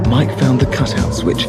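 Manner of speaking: ordinary delivery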